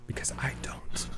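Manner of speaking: hushed, whispered admission; whispered aside. Real, not acted. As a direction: drop to a whisper, opting out. Ducking out of taking lead.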